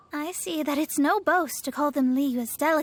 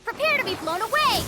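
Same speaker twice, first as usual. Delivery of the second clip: higher, fast and loud; battle cry; 8 semitones higher, 3 dB louder, faster than usual. Acted. Master shouting at enemies in battle, fast fierce battle cry. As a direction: hurl it at the enemy like a war cry.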